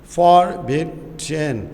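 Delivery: फॉरबिडन very slowly in separate syllables. Shown as a demonstration. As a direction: split फॉरबिडन into spaced, deliberate beats. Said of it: "वो उसका syllable कहलाएगा". (फॉरबिडन को तोड़-तोड़ कर, आहिस्ता से)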